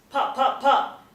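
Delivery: three high, loud bursts; gunfire pops. A narrator's reading. Narrator sounds shots at three students, loud bursts. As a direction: punch each pop, the last loudest.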